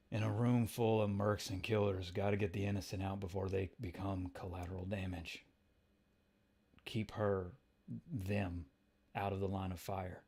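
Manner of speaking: low, quiet, terse inner monologue; gruff-tempered Punisher. Acted. Frank thinking through a room of killers, low and quiet. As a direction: keep it low and soft, sizing up danger.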